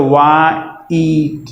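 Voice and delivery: वा ईट as two syllables, वा then ईट; split into syllables. Shown as a demonstration. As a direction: break व्हाइट into वा and ईट. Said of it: "व्हाइट में जब हम लोग sound करते हैं".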